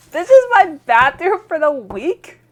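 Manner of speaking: can't believe this is the bathroom; appalled disbelief. Acted. Guest stares at the filthy bathroom, loud and appalled.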